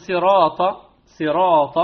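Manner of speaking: صراط with a full heavy emphatic ra; صراط مفخمة. Shown as a demonstration. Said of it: "الراء مفتوحة وقبلها كسر". (نطق صراط بتفخيم الراء)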